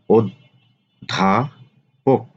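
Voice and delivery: অধ্যাপক slowly as od-dha-pok; slow model pronunciation. Shown as a demonstration. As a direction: stretch অধ্যাপক syllable by syllable. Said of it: "আলোচনা করব শব্দের উচ্চারণ". (অধ্যাপক ধীরে উচ্চারণ)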